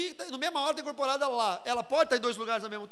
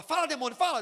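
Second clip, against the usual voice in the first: higher, forceful mock order; pastor commanding demon; 5 semitones higher, 5 dB louder than usual. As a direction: imitate an insistent exorcist's command. Pastor imitado interrogando demônio. (pastor mandando o demônio falar)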